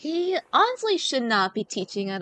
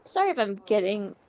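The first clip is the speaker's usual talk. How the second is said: teary; 6 semitones lower, 4 dB quieter than usual. Real, not acted. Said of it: "if I sound very teary".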